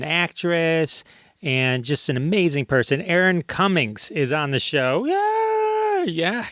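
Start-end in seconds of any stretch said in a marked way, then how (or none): 5.1-6.5 excited cheer. Real, not acted. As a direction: cheer like a crowd hype man. Cheering guest announcement.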